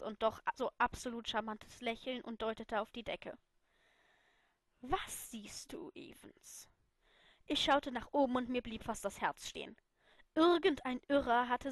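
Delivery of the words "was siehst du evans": slow, soft whisper; whispered tease. Acted. Potter whispers to Lily under the mistletoe. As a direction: breathe it, sly and slow.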